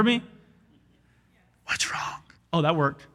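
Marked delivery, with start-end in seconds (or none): 1.7-2.2 breathy stage whisper, no voice at all. Shown as a demonstration. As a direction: whisper it so the back row still hears. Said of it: "I can't whisper 'cause you can't hear me".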